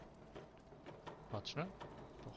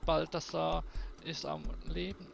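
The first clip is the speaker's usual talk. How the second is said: lightly raised, earnest reading of the story text; game text read-out; 3 semitones higher than usual. Acted. Player reading the hero's thoughts about Balthasar, lightly earnest.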